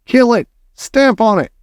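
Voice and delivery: high-pitched urgent yells; shouting. A narrator's reading. Cooks hunting the mouse underfoot, shouting. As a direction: cry out for the kill, pitch up. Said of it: "they were shouting".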